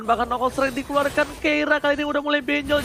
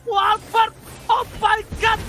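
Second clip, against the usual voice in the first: excited shout; 7 semitones higher than usual. Real, not acted. Caster shouts at a kill.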